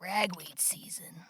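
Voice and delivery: breathy hoarse whisper. Real, not acted. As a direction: rasp it out under breath. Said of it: "Morning voice".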